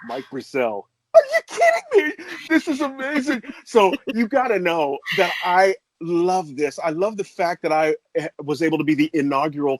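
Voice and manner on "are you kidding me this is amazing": much higher, shouting through tears; overwhelmed, almost crying; a cry of happy disbelief; tearful shout of joy. Real, not acted.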